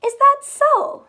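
high, loud, slow mock-doubtful bird voice; a big haughty bird raising its voice in doubt; skeptical robin. Robin sizing up the inchworm, high and doubting. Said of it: "said the robin".

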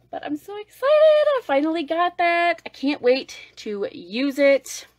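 can barely contain excitement; thrilled. Real, not acted. Telling viewers about the palette, thrilled gushing.

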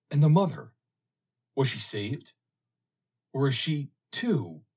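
high, loud, pressing questions; can't wait for the answers; eager questions. A narrator's reading. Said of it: "asked Roland eagerly".

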